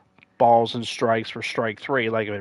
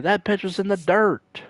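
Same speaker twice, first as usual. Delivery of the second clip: higher, insistent complaint; announcer protest; 9 semitones higher than usual. Retold or imitated. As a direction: insist the call was wrong. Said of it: "they were just like".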